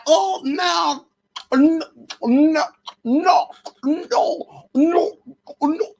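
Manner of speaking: high put-on mocking character voice; Shanae. Vlogger likening a rapper to a comic character, high mocking impression. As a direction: draw out each refusal high, with pauses between. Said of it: "give me K-, Shanae tea".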